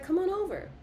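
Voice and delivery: high, sing-song invitation; playful high-voiced welcome; inviting Virgo. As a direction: lure them in, way up high. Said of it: "Virgo's like".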